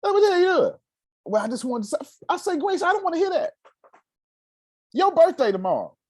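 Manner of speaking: high, impatient re-enacted scolding; retold exasperation. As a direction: act out cutting off a moping friend. Re-enacting argument with Grace.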